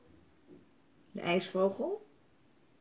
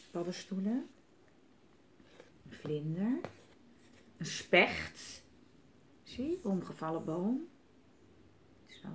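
ordinary delivery

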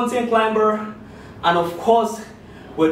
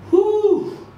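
breathless exhale; 11 semitones higher than usual. Real, not acted. Exhale after final set.

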